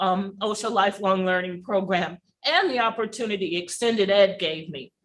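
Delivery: ordinary talk, nothing marked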